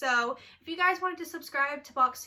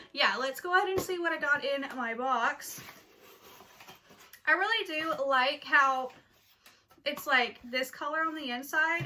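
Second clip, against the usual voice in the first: normal talking voice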